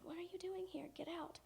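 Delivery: retold hushed, high whisper; urgent whisper. Younger sister to older sister intruding in class, urgent whisper. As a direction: whisper it to shoo someone away.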